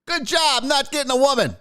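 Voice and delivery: put-on high, hearty old man voice, way up; creepy dad voice. Dad lecturing the kid on the plane, high hearty voice. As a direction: play the chummy preachy dad. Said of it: "old man being like".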